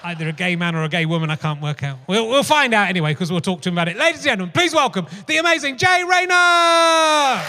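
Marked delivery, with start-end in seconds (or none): 4.0-7.4 announcer introduction. Acted. Host welcoming the guest to the stage, big showbiz shout. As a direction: bring the guest on like a game show host. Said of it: "welcoming guest on stage".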